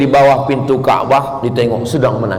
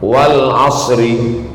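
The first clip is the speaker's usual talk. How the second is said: slow, chanted recitation voice; slow, chanted Quran recitation; 3 semitones lower, much slower than usual. Acted. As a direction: intone each verse slowly, reverently.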